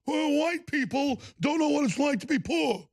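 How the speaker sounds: high, affected put-on politician voice; mocking Peto O'Rourke. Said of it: "he has this fake thing".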